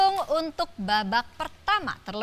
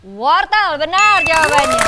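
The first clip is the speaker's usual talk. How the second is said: excited announcer; 6 semitones higher, 9 dB louder than usual. Real, not acted. Host confirms right answer.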